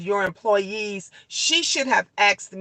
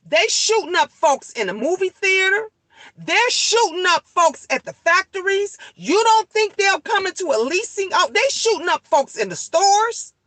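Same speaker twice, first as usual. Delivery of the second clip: fired-up appeal; 9 semitones higher than usual. Real, not acted. Calling on Coco to agree.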